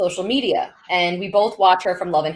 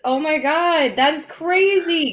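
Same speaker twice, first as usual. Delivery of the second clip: excited disbelief; 7 semitones higher, 3 dB louder than usual. Real, not acted. News of quick second pregnancy.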